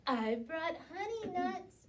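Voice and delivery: grinning badger voice; badger with a grin. A narrator's reading. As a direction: offer it with a grin. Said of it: "Badger says with a grin".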